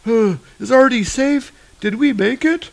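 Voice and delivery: high, dazed wounded voice; delirious angel. Acted. Wounded angel asking Thor about Artie, high dazed voice. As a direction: mumble it high and lost. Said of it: "He's kinda delirious".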